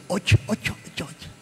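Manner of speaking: breathy whispered ocho ocho of a rich patient; mock whispered moans. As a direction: whisper the pain in English-style moans. (pabulong na daing ng mayamang pasyente)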